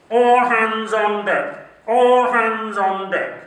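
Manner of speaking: slow, loud call muffled through a cupped hand; captain calling through hand. Acted. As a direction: bellow it like a ship's captain through his hand. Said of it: "speak like that with their hand in front of their mouths".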